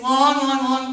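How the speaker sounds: "long, long" in an exaggerated storyteller's build-up; storybook opening. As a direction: lean hard on each "long". Storybook opening, then corrects herself.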